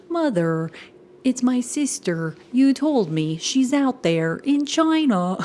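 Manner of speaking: mocking a TV son's stilted acting; the Smash son. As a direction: ham up the overacted line slowly. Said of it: "the way he talks".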